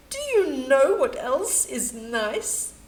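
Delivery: ordinary delivery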